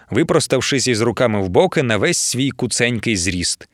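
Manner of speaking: plain reading voice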